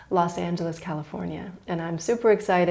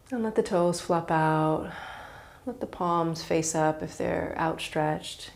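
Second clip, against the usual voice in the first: calm, unhurried yoga nidra guidance with long pauses; guided meditation. Real, not acted. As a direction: ease them into relaxation, letting each phrase settle.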